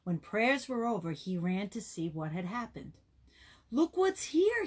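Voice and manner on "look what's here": high, loud, bright call of discovery; excited call. Acted. Boy finds a bundle after prayer, excited high call. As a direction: call out thrilled, pitch jumping up. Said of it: "he called as he lifted".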